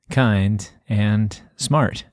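"Kind and smart" drawn out slowly, beat by beat; metrical scansion. Shown as a demonstration. Co-host completing the pentameter line, slow scansion. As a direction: finish the verse in slow measured beats.

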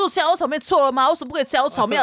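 indignant. Retold or imitated. Quoting partner in the noodle quarrel, indignant. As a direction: act out a partner's indignant complaint. Acted retold quarrel over food.

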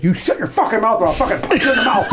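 high, mock-furious threat; hammed-up angry outburst at a heckler; Walter Perizzato. Saxophonist walking over to threaten a fan, imitated fury. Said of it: "he walks over and he goes".

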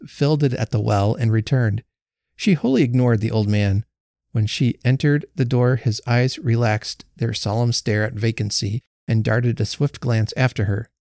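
ordinary delivery, nothing marked

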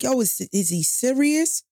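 half-laughing who-is-this; retold disbelief. She reacts to the man stalking her, startled. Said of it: "I was like".

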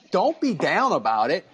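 ordinary delivery

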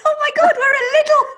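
high, loud squeal of delight; excited car rat. Acted. Car rat thrilled to reach the Lidl bakery, excited. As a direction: squeal it like an overexcited little rat. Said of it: "getting all excited about a croissant".

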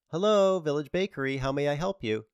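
put-on high, bright-toned teen girl on the phone; sweet teenage voice. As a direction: chirp it like a young shop clerk. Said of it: "A sweet teenage voice answered".

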